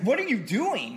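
loud, high mock yell; startled instructor shouting at the student driver; retold yell. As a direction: yell it like a panicked driving examiner. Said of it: "then he just yelled at me".

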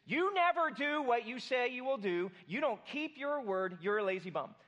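sling the blame at your spouse; a mock angry accusation; accusing. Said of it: "Criticism is".

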